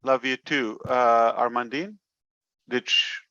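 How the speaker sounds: ordinary talk, nothing marked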